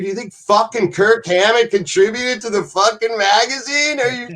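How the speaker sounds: high-pitched incredulous rhetorical question; scornful disbelief. Real, not acted. Storyteller scoffing at a bandmate contributing, incredulous. Mocking the idea of band contributions.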